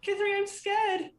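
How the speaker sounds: put-on high, slow frightened little kid; scared child. Child telling her sister she is scared. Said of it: "one of the children says to the other".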